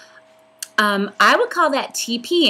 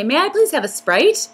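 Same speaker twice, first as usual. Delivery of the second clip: bright, higher, sweetly polite ordering; polite request; 8 semitones higher, 3 dB louder than usual. Shown as a demonstration. Vlogger showing how she orders a soda, sweetly polite. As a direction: ask nicely like at a counter. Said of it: "I say".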